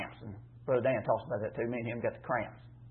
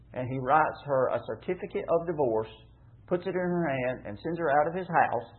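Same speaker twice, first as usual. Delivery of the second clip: scripture reading; 2 semitones higher, 4 dB louder than usual. Acted. Preacher reading Deuteronomy aloud to the church, measured. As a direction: deliver the passage like a formal reading.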